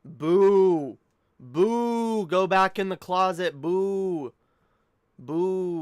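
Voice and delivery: jeering boos; booing crowd. Retold or imitated. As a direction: boo like a hostile crowd.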